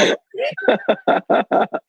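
high burst of laughter. Real, not acted. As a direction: crack up at your own story. Laughing at the story.

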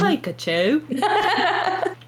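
Pikachu in a drawn-out British accent; British accent, very slow Pikachu. Shown as a demonstration. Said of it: "How does he say Pikachu?".

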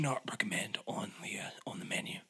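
hushed whisper. Acted. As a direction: lean in and whisper it. Chef whispers question to Sally.

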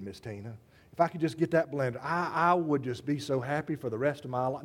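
lightly mocking wishful shopper; wistful wanting voice. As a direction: sigh after things you can't have. Mimicking consumer longing.

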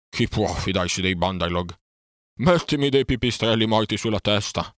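groan, then wail in self-reproach; wailing despair. A narrator's reading. Bear fears the boy was dropped and blames himself, wailing loudly.

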